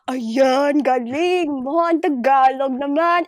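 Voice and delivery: loud, high, drawn-out mock amazement; gushing relatives. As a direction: imitate family making a huge fuss. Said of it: "make such a big deal out of it".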